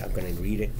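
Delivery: ordinary delivery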